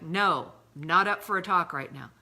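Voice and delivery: loud, sharp brush-off; curt refusal. Retold or imitated. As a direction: snap back a swift no. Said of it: "setting a swift boundary and being like".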